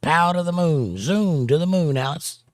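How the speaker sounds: loud, high blustering threat; Ralph Kramden impression. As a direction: bellow it like an angry sitcom husband. Jackie Gleason Honeymooners threat.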